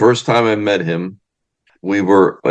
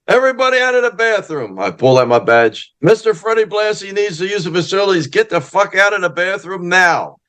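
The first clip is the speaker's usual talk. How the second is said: high, commanding cop voice barking orders; mock police order; 9 semitones higher than usual. Narrator clearing a bathroom for Freddie, in a high mock-official command. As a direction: play the pushy officer clearing the room.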